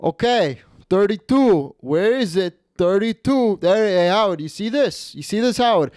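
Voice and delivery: high-pitched Italian-American gambler making a show of it; mock showboating before the throw; Stefano. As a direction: imitate a cocky gambler calling the dealer to watch. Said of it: "So he would go".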